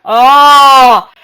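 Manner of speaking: loud, drawn-out startled "oh"; startled cry. Real, not acted. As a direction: cry out as you fall. Falls in the game.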